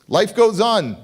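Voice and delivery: ordinary talk, nothing marked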